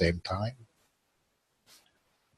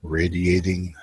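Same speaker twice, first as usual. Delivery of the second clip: guided meditation. Acted. Host guiding his viewers into relaxation, calm guided meditation. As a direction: ease the listeners into stillness.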